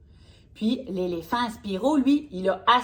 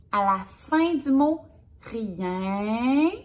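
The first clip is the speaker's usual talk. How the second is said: ordinary talk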